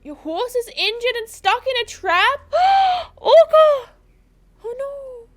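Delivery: high, loud alarmed cry with a gasp; shocked. Real, not acted. Streamer learning her horse is trapped, shocked outcry.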